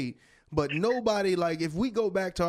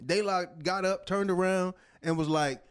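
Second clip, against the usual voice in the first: ordinary delivery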